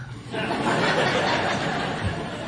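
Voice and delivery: breathy, quiet chuckle; soft laugh. Real, not acted. Pastor laughing at his lazy morning excuse, quiet chuckle. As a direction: laugh softly at your own joke.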